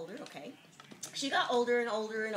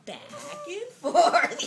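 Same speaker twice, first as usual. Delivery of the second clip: slow drawn-out rocking, breaking into a laugh; lull, then crack up; 9 dB louder, much slower than usual. A narrator's reading. Mother rocking the grown boy.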